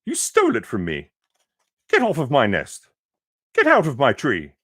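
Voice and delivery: loud, fast scolding; the bird scolds Horton off her nest; angry demand. Acted. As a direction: chase him off the nest. Angry bird orders Horton away.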